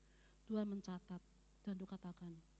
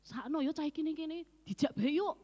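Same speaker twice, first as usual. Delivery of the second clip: raised, louder coaxing voice, a bit higher; high, loud, beckoning call; voice of the dead person; 7 semitones higher, 8 dB louder than usual. Retold or imitated. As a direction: coax like a stranger luring her along. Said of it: "Dan satu orang yang berkatakan".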